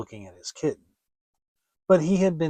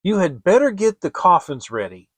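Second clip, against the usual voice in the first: harsh stepmother; 4 semitones higher, 8 dB louder than usual. A narrator's reading. Stepmother berating the woodcutter, loud and harsh. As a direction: hammer him into giving in.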